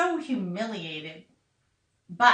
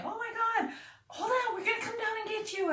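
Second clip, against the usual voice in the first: high, soft mock concern, rushed; imitating peers; 12 semitones higher, 7 dB quieter, faster than usual. Peers leaning over the edge, high soft mock concern.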